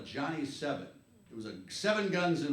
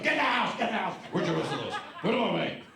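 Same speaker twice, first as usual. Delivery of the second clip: louder, higher alarmed yell; dad yelling; 4 semitones higher, 4 dB louder than usual. Retold or imitated. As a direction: shout it like a panicked father. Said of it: "My dad's going".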